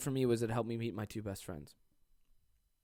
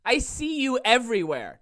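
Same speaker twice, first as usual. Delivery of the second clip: louder, slow, higher indignant callout; mock confrontation; 9 semitones higher, 10 dB louder, slower than usual. Shown as a demonstration. Showing a fan how to confront the person who ghosted her. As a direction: call them out loudly in public.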